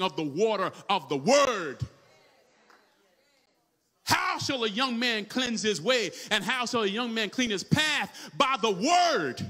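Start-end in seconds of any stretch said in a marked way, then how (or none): 4.0-9.4 slow, declaimed verse building to a loud close; preached scripture. Real, not acted. Preacher quotes scripture to the church, declamatory preaching. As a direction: stretch out the verse and land hard on its ending.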